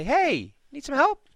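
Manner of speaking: high, sing-out offer of help; mock friendly hail. Passing ship offering help instead of attacking, high mock hail.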